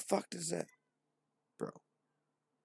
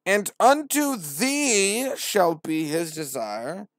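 much higher, louder, slow proclamation; grand verse; 8 semitones higher, 9 dB louder, much slower than usual. Acted. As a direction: boom the warning. Verse read out.

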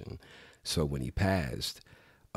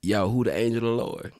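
higher and louder, "who the angel of the Lord" run together with no syllables; his own slang accent; 6 semitones higher, 5 dB louder than usual. Shown as a demonstration. Friend answers host's request in his own slang accent, words run together. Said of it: "in my accent".

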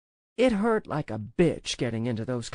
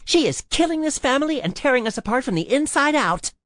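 loud, high, heated accusation; heated outburst, pitched up; angry snap; 7 semitones higher, 6 dB louder than usual. A narrator's reading. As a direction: lash out with raised voice. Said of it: "she snapped".

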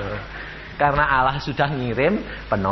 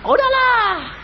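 retold scolding; 4 dB louder than usual. Preacher scolding his frightened crew.

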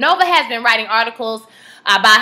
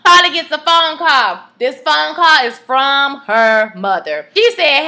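ordinary delivery

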